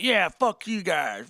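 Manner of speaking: slow, drawn-out mock defiance; punk rock defiance. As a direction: sneer it like a punk who doesn't care. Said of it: "punk rock".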